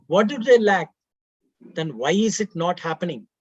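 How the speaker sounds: usual speaking voice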